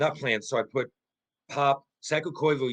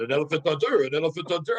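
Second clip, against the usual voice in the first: imitating a French-accented teammate; mock French-accented English; 2 semitones higher, 3 dB louder than usual. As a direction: put on a French accent, a bit louder. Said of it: "He's saying in his French accent".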